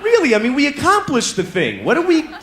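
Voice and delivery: high, incredulous pleading; exasperated disbelief. Retold or imitated. Exhausted man protests at doing it again, high disbelief. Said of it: "And I was like".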